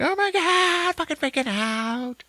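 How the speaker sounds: hammed-up panic squeal; mock freak-out. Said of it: "she's like".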